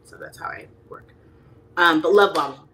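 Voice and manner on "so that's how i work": whispered aside. Real, not acted.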